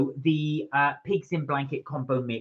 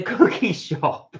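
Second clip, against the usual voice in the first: ordinary talk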